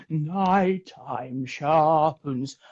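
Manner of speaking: drawn-out slow delivery; very slow mock line. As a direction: stretch every syllable. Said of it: "Start, start going".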